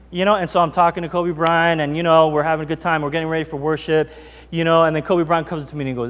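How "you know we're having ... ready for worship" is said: normal talking voice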